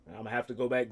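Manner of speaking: ordinary delivery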